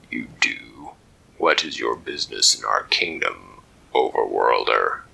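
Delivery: a stern armored sentry; deep lobster guard. Acted. Lobster guard stopping the intruder, low stern voice.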